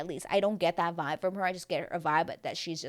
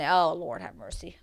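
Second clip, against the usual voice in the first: usual speaking voice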